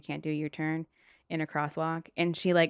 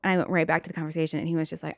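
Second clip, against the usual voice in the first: normal talking voice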